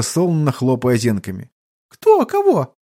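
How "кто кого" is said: put-on very high childish questions; puzzled little girl. Drowsy child slow to understand, puzzled squeak. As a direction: squeak it out, not following. Sleepy child keeps asking back.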